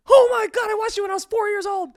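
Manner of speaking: high, very loud mock gushing; imagined fan freak-out. Twenty-year-old fan to childhood idol, mock freak-out. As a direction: shout it like a thrilled longtime fan.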